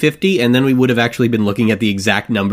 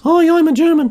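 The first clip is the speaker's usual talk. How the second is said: very high, silly put-on German; mock German. Sample from a joke accent ad, mock German in high voice. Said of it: "accents we like to do include Germans".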